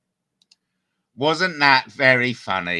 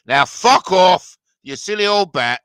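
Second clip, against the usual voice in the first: mock angry dismissal; 7 semitones higher, 7 dB louder than usual. Parodies host dismissing the caller.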